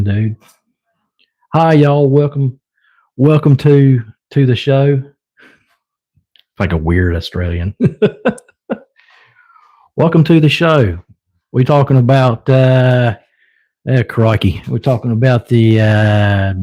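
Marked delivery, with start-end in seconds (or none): 1.5-5.0 Australian accent. Shown as a demonstration. To the stream viewers, a mock Australian host welcome. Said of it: "I can talk like an Australian". 10.0-16.5 bad Australian accent. Shown as a demonstration. Showing viewers his bad Australian accent, mock Aussie. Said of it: "bad Aussie accent".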